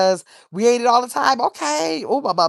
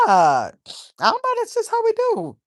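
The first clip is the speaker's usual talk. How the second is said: high playful shrug; 14 semitones higher than usual. Real, not acted. Shrugging off family's foolishness.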